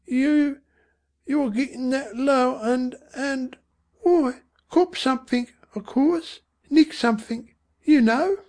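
high voice halting between words; faltering mother. A narrator's reading. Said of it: "she said, but falteringly".

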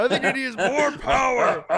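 high, loud, laughing through the line; mock director, laughing. Director telling Chris Evans in a laughing high voice. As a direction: boss voice cracking up with laughter.

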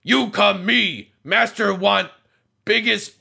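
high golem voice breaking into laughter; the golem leads the party to the master, giggling. Acted. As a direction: play the dim golem but keep cracking up.

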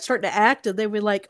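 normal talking voice

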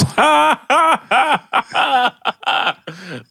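long, high burst of real laughter; helpless high-pitched cackle; big laugh. Real, not acted. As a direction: crack up at an unexpected punchline. Laughs at the story's abrupt end.

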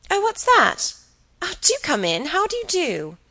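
high, fluttery welcome; surprised greeting. Acted. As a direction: caught off guard, greet the guest in a high voice. Helen greets an unexpected guest.

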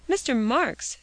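measured and puzzled; slowly. A narrator's reading. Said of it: "said Phronsie slowly".

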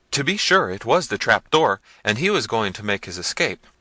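loud, eager tattling; officiously. A narrator's reading. Servant eagerly informing the prince, officious. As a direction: push in to please the master. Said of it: "interrupted one of them officiously".

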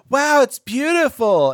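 loud, high, drawn-out mock awe; gushing girlfriend. Sound girl interrupting her own recording, mock gushing. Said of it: "before she's like".

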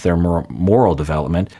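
normal talking voice

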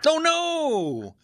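high dismayed oh no; surprised. Real, not acted. Reacts to caller's wired jaw.

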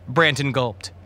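ordinary delivery, nothing marked

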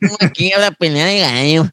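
put-on high, hammy Mexican accent. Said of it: "Llego así con el acento mexicano".

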